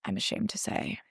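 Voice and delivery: hushed, whispered confession; near-whispered embarrassed admission; sheepish aside. Real, not acted. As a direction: drop to a whisper, a little ashamed.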